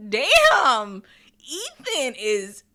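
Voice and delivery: loud, high, drawn-out exclamation; impressed shock. Real, not acted. Reacting to Ethan's cold comeback, impressed shock. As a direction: let it burst out long and loud. Stunned reaction to the boy's line.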